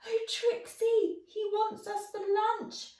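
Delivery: put-on high, frightened boyish stammer. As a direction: tell the dog in fear of being eaten. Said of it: "stammered George".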